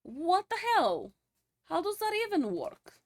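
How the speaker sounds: put-on a sudden Russian accent, baffled by the game; slipped into an accent. Streamer puzzling over the game, in a Russian accent. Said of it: "I don't know where the accent is coming from".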